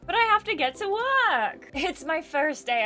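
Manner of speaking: high, loud, worried plea; anxious protest. Acted. Heroine fears being late first day, high anxious protest. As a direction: pitch shoots up in protest.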